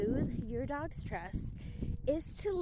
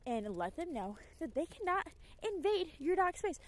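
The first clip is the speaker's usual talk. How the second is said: ordinary talk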